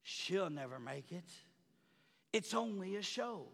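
imitating a scornful churchgoer, hushed; dismissive naysayer. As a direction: toss it off like a cynic writing someone off. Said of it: "Instead of saying".

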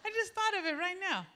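laughs through the words, high and fast; cracking up at her own confession; giggling as she admits she never paid; laughing. Real, not acted. Laughs at her own unpaid bill.

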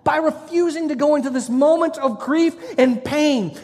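high-pitched, impassioned sermon delivery; emotional preaching. Real, not acted. Pastor pleading with congregation about repentance, emotional preaching. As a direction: preach it urgently, pitch held high throughout. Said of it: "why am I getting emotional about this".

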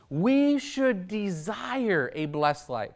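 quiet, slow, earnest restatement; a hushed, deliberate echo of the line. Shown as a demonstration.

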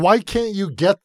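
normal talking voice

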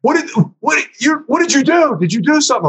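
high-pitched mock panic; high, stammered, panicky questioning; imitating freaking out. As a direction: play the boss freaking out, voice shooting up. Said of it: "she's freaking out".

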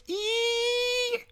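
mock jerk-off squeal, very high; a piercing mock squeal. Mocking Bill Gates, a shrill squeak. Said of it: "That's the sound he makes".

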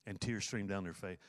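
usual speaking voice

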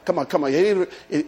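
ordinary talk

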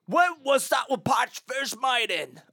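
put-on a clumsy British accent, slow; hammed-up British accent asking Siri; bad British accent. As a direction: fake British accent calling Oi. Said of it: "That was so bad".

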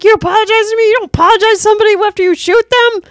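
high, loud indignant imitation; mock outrage. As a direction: protest in hammed-up, rising disbelief. Quoting outraged shooting victim in film.

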